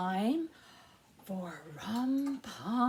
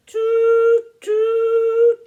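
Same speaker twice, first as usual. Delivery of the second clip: high, loud train whistle toots; piping train whistle sounds; 11 semitones higher, 15 dB louder than usual. Pretend. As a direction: toot like a little train whistle. Train whistle in children's rhyme.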